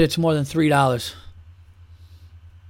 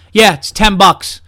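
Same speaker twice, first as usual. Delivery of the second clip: slow, louder, flat refusal to haggle; staring them down, no budging; dead-eyed firm; 5 semitones higher, 7 dB louder, slower than usual. Pretend. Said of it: "look them dead in their eyes and just go".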